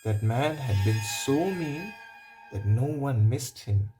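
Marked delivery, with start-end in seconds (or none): none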